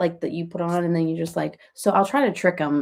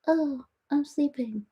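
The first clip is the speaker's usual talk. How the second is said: sleepy cat voice; 7 semitones higher, 6 dB quieter, slower than usual. Pretend. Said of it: "she'll be like".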